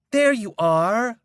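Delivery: high, slow, sultry woman's voice; flirty woman. A narrator's reading. Woman grabbing the narrator's arm outside the courthouse, high and flirty. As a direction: purr it out as a flirting stranger.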